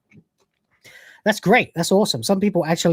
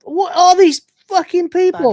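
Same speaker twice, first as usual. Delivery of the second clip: incredulous; 11 semitones higher, 5 dB louder than usual. Real, not acted. Incredulous at characters.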